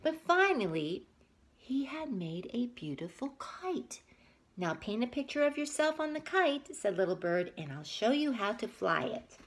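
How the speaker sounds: put-on reading a picture book aloud to kids; story narration. A narrator's reading. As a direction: tell it gently to little listeners.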